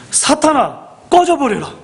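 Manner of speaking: high-pitched, stern banishing command; sharp dramatic rebuke, voice jumping high; acted rebuke. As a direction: cast them out in an acted scolding. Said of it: "뭐라고 꾸짖으셨다고 기록됩니까".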